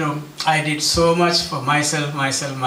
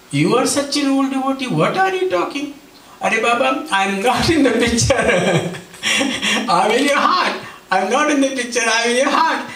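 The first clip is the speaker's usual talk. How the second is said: Swami teasing; 6 semitones higher than usual. Retold or imitated. Swami teasing speaker laughing.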